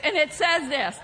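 normal talking voice

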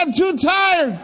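very high, loud comic complaint; hammed-up shouted whine of exhaustion; wailing protest. Worn-out husband answering the doctor, wailing protest. Exhausted coach wails back.

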